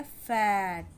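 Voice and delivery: "फैट" said slowly with the short A; careful, stretched "फैट"; short A demo. Shown as a demonstration. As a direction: model "फैट" clearly for learners. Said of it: "आ का sound आया".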